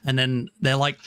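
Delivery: ordinary talk, nothing marked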